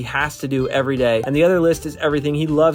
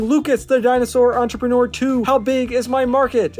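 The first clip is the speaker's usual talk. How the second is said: grand book-title announcement; 9 semitones higher, 4 dB louder than usual. Acted. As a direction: present the title like a big reveal.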